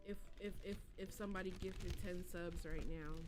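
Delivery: low, quiet explanation; subdued. Real, not acted. Streamer owning up to a rash promise, subdued. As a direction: confess it softly, pitch sinking.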